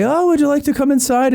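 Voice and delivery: very high, lilting polite invitation; voiced Irish host. Irish couple invite her inside, put-on high voice. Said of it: "not gonna try to do a broke".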